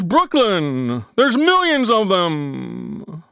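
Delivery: slow, drawn-out croaking frog; slow frog croak delivering the punchline. Acted. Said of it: "the frog says".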